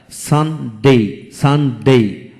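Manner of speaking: Sunday in two separate beats, san, de; split into syllables. Shown as a demonstration. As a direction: pause after সান in Sunday. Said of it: "দুটি syllable থাকে".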